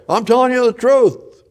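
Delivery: high, loud oath; Peter swearing. Acted. Peter swears loudly to the men by the fire, high and desperate. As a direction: yell it like a cornered man swearing. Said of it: "cursed and swear".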